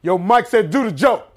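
high, urgent imitation of a messenger; hammed-up insistent relay; man relaying orders. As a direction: call out the boss's order. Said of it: "the dude at the table".

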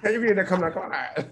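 slow villain voice, imitating Bane; drawn out like a movie villain. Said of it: "That's what it sound like".

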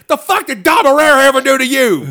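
high, loud indignant demand; imitated indignant rant. Comic defends Dom Irrera to the crowd, loud indignant. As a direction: defend a fellow comic angrily. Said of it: "he was like".

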